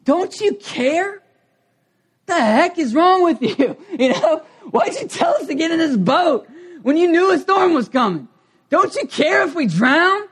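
high, loud frantic pleading; panicked disciples. Acted. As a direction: cry out in panic at the sleeping teacher.

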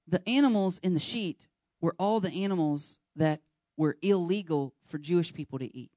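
normal talking voice

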